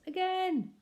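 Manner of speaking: put-on high, loud delighted toddler shout; baby shouting. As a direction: squeal it like a gleeful baby. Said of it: "The baby laughs and shouts".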